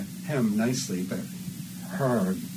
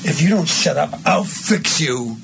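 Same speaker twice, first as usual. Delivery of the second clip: threat; 6 semitones higher, 4 dB louder than usual. Shown as a demonstration. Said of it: "this is that big line".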